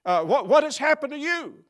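high, loud sneering harangue; mocking harangue. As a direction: needle them like a gloating critic. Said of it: "maybe even a harangue".

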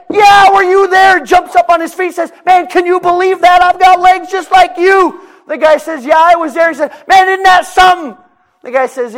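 high, loud acted exchange; passerby confronting the beggar; comic street dialogue. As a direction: play both men arguing.

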